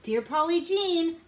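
ordinary delivery, nothing marked

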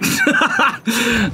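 high burst of laughter; exasperated laugh. Real, not acted. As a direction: laugh off your frustration. Laughing at own misses.

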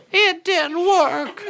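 put-on high, slow child's voice with a laugh; a disappointed little boy, drawn out; little brother's voice. Little brother reports failure, high slow child voice. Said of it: "And he said".

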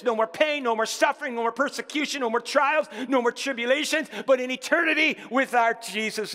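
high, punchy pulpit delivery; rally the congregation with a rising, driving list; exultant preacher in full flow; rousing preaching. Real, not acted.

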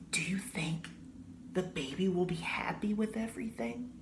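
soft unvoiced whisper. A narrator's reading. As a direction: whisper it close, like a secret. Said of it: "he whispered".

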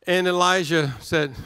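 usual speaking voice

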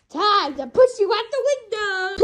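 gleeful threat shout. Acted. Host ejecting whoever just screamed, high shouted threat.

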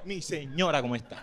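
low, softened polite greeting; mock courteous greeting. Comic answering the lady who called, low courteous tone. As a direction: drop low and courteous, sinking at the end.

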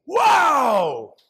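loud, high amazed shout; wow. Retold or imitated. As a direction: gasp out loud at a shooting star. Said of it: "What do you say when you see".

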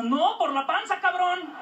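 high mock mother snapping back; mom's punchline. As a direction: deliver the mom's jab up high. Mom retort.

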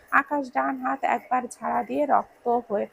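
ordinary delivery, nothing marked

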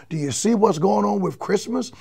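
ordinary talk, nothing marked

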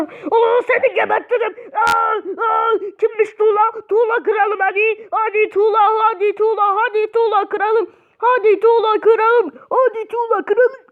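high-pitched frantic fight chant; overexcited play-fighting cries; mock battle shout. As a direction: yell threats in a hammed-up frenzy. Mock fighting, threatens game opponent.

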